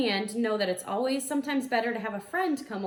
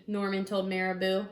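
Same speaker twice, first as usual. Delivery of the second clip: ordinary delivery, nothing marked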